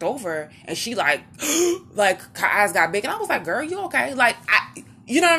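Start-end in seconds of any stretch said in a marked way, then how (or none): 1.3-1.9 sharp high imitated gasp; shocked gasp. Imitating the girl in the park, a high startled gasp. 3.4-4.1 high, teasing question; amused check-in. Retold or imitated. To the shocked girl in the park, a high amused question.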